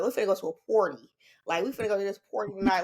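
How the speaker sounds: "party" said with an O sound, like LA folks say it. Retold or imitated. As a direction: say "party" with an open O, the Southern California way. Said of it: "the way they say party".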